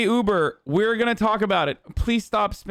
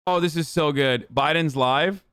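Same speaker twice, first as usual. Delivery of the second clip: ordinary delivery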